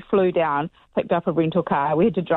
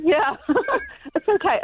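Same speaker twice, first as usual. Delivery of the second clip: laughing it off, real and higher; 11 semitones higher than usual. Real, not acted. Guest laughing as she tells the hosts about the ruined car. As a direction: giggle through the words.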